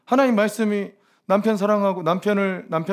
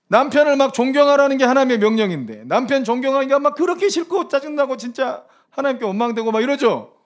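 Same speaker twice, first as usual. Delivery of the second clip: loud teasing preaching; 6 semitones higher, 4 dB louder than usual. Acted. Preacher teasing congregation loudly.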